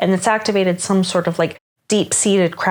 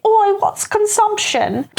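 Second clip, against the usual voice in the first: mock Cockney, much higher and slow; hammed-up Cockney, a Victorian street voice; bad Cockney accent; slower than usual. A Victorian asking about consumption, in a bad mock Cockney accent. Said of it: "the worst little Cockney accent".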